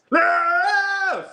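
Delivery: very high, drawn-out loud cry; sudden mock shriek. Reader acting out the other person, mock shriek. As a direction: burst out in an over-the-top squeal.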